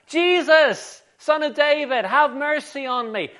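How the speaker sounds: loud, high-pitched beggar's cry; acted shout for attention. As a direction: call out desperately across a crowd. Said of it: "He starts shouting out".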